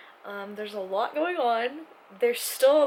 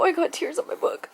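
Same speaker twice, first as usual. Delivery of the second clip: crying; 7 semitones higher, 6 dB louder than usual. Real, not acted. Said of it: "I can't stop crying".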